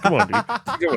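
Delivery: high, laughing come on dude; mock exasperation. Dad imitating himself to nosy adults, laughing exasperation. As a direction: plead with a mock whiny laugh.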